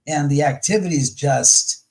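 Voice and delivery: ordinary talk, nothing marked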